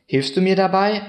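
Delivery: lightly voiced high pleading question; child's plea. A narrator's reading. Boy asks the Christ child for help, lightly pleading.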